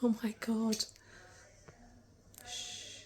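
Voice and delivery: hushed urgent whisper. Real, not acted. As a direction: shush him under your breath. Hushing a shouting patient.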